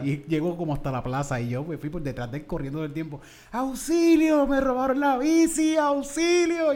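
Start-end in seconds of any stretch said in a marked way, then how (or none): 3.5-6.7 high, loud cry of auxilio; shouting auxilio as a kid chasing the thief; retold yell for help. Boy chasing his bike thief, yelling for help. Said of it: "grité tanto".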